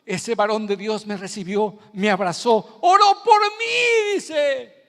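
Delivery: high, loud crying plea; retold crying. Preacher voicing the weeping ex-hitman, crying loudly. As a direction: cry out his words through tears. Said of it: "Y decía él llorando".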